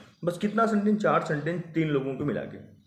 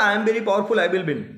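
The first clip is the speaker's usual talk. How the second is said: loud, higher, proud declaration; confident boast; 5 semitones higher, 6 dB louder, slower than usual. Retold or imitated. Teacher voicing a student who boasts to win a debate, loud and proud. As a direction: proclaim victory to the opponent.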